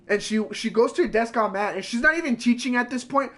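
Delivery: normal talking voice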